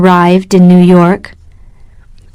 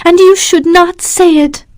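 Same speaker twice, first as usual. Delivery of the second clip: cried out; 9 semitones higher than usual. A narrator's reading. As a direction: cry out against him, voice lifted high. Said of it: "she cried".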